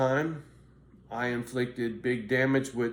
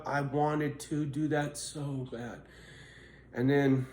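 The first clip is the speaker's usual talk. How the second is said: ordinary talk, nothing marked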